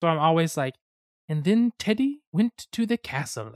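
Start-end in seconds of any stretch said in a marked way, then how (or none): 1.3-3.5 higher, silly put-on voice; dumb storyteller voice. Shown as a demonstration. Telling a game story about Teddy, dumb voice. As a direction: narrate in a goofy high voice. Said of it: "do some dumb voice".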